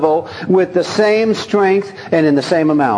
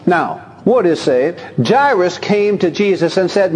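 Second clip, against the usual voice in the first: ordinary talk, nothing marked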